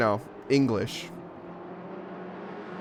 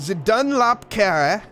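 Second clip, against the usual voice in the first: slow, raised, French-sounding flourish; French accent; 6 semitones higher, 5 dB louder, slower than usual. Shown as a demonstration. Commentator testing a corner's name, exaggerated French accent. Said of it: "it sounded French 'cause I made it sound French".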